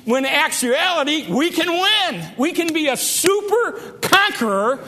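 rising triumphant sermon peak; preaching at full force. Acted. Preaching climax.

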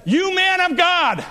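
high, drawn-out captain's summons; crying out. Retold or imitated. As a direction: holler a command up the hill. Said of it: "the captain cries out to him".

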